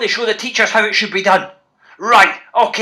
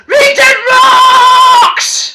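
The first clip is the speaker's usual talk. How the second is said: full-throated shout, much higher and louder; 14 semitones higher, 10 dB louder, much slower than usual. Shown as a demonstration. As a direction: belt it out for the kids to copy. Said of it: "Loud as you can".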